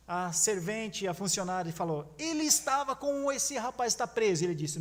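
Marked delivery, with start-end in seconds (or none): 2.2-4.4 higher, accusing servant's voice. Acted. Servant girl tells the crowd Peter was with Jesus, accusing. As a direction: point him out sharply, higher.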